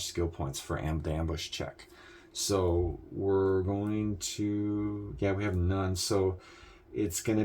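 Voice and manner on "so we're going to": normal talking voice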